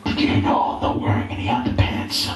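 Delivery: low, whispery prank-call voice; creepy brother voice. Retold or imitated. Ben on a dawn birthday call, low whispery creep voice. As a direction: breathe it down the phone like a creep.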